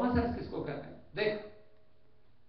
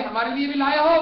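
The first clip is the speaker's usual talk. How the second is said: much louder, higher eager call; 5 semitones higher, 12 dB louder than usual. Acted. Crowd eagerly asks the brother for common sense, loud. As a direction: shout across the crowd.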